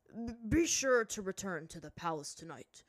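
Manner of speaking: loud, formal invitation. A narrator's reading. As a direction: invite with a chief's authority. High Chief figure speaks.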